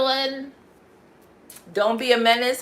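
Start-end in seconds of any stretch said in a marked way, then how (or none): 1.7-2.6 mock Southern accent, a bit loud; playful take on a Southern speaker. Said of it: "how they talk over there down south".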